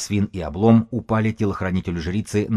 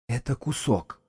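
higher, softer rasp; hoarse, rough voice; 5 semitones higher, 5 dB quieter than usual. A narrator's reading. Priest reporting to the others who the dead man is, hoarse and rough. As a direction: say it in a hoarse, scratchy voice. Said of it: "своим сиплым шершавым голосом".

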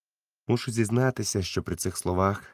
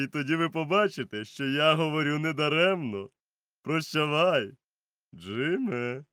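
higher voice, stern doctor; doctor advising; 8 semitones higher than usual. A narrator's reading. Doctor warns Silver, higher and firm. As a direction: give a warning with authority. Doctor gives parting advice.

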